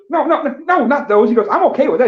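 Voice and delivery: a dad waving off the wrong answer; hammed-up Southern dad.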